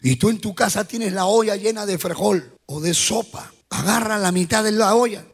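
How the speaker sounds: ordinary talk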